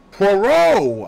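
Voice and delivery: high, loud outburst; excited cry. A narrator's reading. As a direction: cry out the name, bursting with excitement. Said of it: "I cried".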